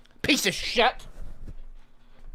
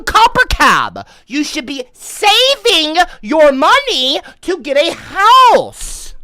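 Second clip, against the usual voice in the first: much higher and louder, slowed, half crying; mock outraged yell; 14 semitones higher, 10 dB louder, slower than usual. Streamer yells at a homeless donor for donating. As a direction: rant in hammed-up disbelief.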